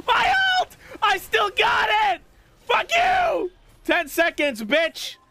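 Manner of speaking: triumphant shouting. Real, not acted. Ult back, taunting enemy.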